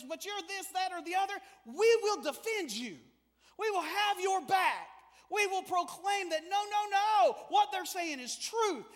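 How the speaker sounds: loud, rising preacher's cry; full-force preaching. Acted. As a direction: drive it home to the congregation.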